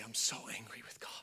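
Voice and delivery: imitated whispered confession; hushed, acted angry-crying confession; tearful whisper. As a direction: whisper it quickly, as if upset. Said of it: "With tears".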